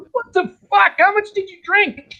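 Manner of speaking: very high, strained disbelieving squeal; freaked-out stoned monkey. Acted. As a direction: squeak the punchline like a panicked monkey. Monkey sees huge gator, punchline.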